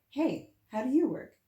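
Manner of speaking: mock calling to a talking object; playful mock call. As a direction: shout the question brightly, twice. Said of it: "Imagine if you could just be like".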